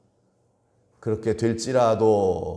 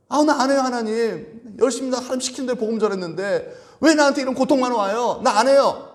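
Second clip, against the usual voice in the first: higher, louder whining protest to God; hammed-up complaint, higher and louder; mock protest; 11 semitones higher, 4 dB louder than usual. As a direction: cry out as if quitting on God. Imagined Paul quitting in protest.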